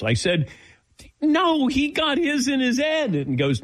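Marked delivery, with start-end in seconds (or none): 1.2-3.1 very high-pitched mock objection; incredulous protest. Patient correcting the doctor about the shots, high-pitched disbelief. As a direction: object in a squeaky-high retold voice.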